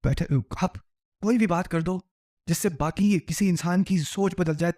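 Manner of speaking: usual speaking voice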